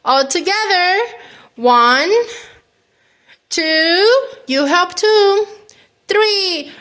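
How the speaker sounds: playful calling-out; rallying countdown. Pretend. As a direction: count everyone in for the big heave. Rallying a listener to push.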